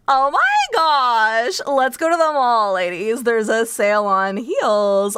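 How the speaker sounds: put-on drawn-out, gushing Valley Girl accent; mock Californian girl. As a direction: stretch the words like a hammed-up California mall girl. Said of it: "the most Californian thing".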